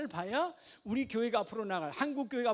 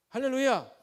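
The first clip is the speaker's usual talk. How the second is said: ordinary talk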